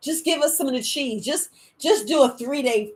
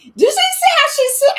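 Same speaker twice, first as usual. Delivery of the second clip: much higher, louder put-on voice; mocking quote; 9 dB louder than usual. Mimicking the girls who mocked her, high put-on voice. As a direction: squeal it like the gossiping girls. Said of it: "they was like".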